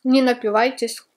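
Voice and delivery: speaking after a bite; chewing while talking. Real, not acted. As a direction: mouth partly full. Seen 0: Bite at -3.0; lips pressed, likely chewing until about 1.8.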